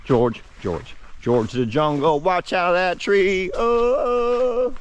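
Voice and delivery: high playful call, rising to a yelp. Pretend. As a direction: call out like George of the Jungle. Calling out to friend, jungle.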